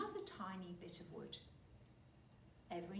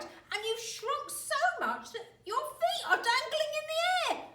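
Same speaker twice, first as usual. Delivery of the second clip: put-on high, loud, cackling old man; gloating shout; 14 dB louder than usual. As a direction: shout it in triumph. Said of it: "he cried".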